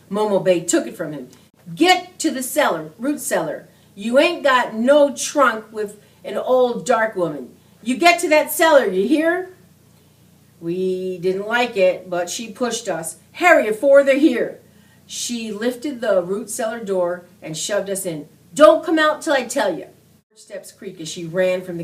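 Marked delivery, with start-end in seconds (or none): 1.7-9.5 higher, louder urgent order; urgent command. A narrator's reading. Old woman ordering boys into cellar, urgently loud. 13.3-14.6 louder, higher hurry-up call; urgent loud plea. A narrator's reading. Momo Bay rushing boys before marauders arrive, loud urgency. 18.5-19.9 louder, higher firm order; firm loud command. A narrator's reading. Momo Bay shutting boys in cellar, firm loud order.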